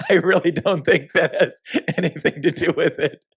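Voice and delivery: can't help laughing while saying it. Real, not acted. Laughs while mocking activists' claims.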